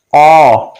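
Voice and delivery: ओ opened to an "aw" sound; short open O. Shown as a demonstration. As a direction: open the lips from ओ towards "aw". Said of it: "short हो जाएगा". (खुला ऑ, ओ नहीं)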